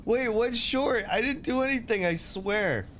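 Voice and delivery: mock innocent protest. To chat teasing him about a short, mock-innocent high whine. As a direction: play the falsely accused, whining I swear.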